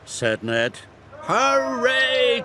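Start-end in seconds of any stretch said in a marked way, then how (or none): 1.2-2.4 high, drawn-out cheer. A narrator's reading. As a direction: cheer out high like jubilant workmen. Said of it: "cheered the workmen".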